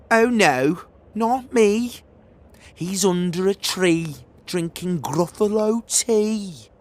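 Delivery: high, loud, sing-song fox voice; the fox brushing her off; sly fox. Acted. Fox telling the child where the mouse is.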